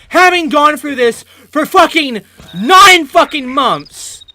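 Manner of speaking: ordinary talk, nothing marked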